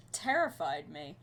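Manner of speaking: ordinary talk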